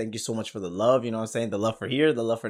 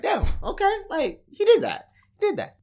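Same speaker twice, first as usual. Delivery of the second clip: impressed exclamation; 5 dB louder than usual. Retold or imitated. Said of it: "I'd be like".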